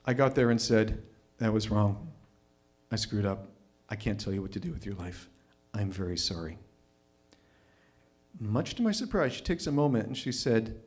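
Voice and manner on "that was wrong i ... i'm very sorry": sincere apology. Retold or imitated.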